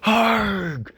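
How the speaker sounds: high startled "Harg!" cry; dismayed cry. A narrator's reading. Mike crying out as the bunnies appear. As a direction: yelp it out in dismay. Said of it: "cried Mike".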